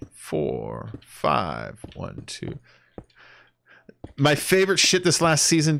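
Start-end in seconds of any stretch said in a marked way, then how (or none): 0.1-2.6 whispered count, much softer. Pretend. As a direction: breathe the numbers out under your breath.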